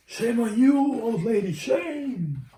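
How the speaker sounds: scolding birds. Acted. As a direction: shoo her off like angry little birds.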